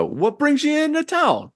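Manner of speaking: high, brash character voice; gangster greeting. Acted. Host introducing himself to the newcomer, high and brash. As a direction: come on friendly and pushy like a mobster. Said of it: "he says".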